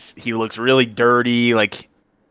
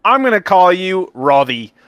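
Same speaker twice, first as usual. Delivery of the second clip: high-pitched small halfling; lightly voiced little character handing out a nickname; Pavel; 7 semitones higher, 4 dB louder than usual. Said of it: "notice him to be a halfling".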